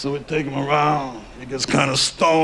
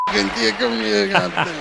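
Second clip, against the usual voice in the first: put-on higher, amused mobster accent; fond gangster pinching the kid's cheeks, Mafia accent; 4 semitones higher than usual. Mobster fusses over the kid, Mafia accent. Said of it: "interesting Mafia accent right there".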